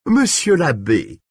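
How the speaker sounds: high, drawn-out mockery; mock him with exaggerated deference; derisive. A narrator's reading. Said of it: "avec un ton de dérision".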